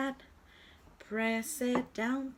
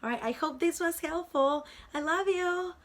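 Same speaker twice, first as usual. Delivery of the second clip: bright, rising louder farewell; cheerful goodbye; 8 semitones higher, 4 dB louder than usual. Acted. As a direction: sign off with excitement. Cheerful sign-off.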